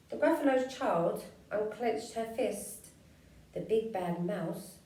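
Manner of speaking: usual speaking voice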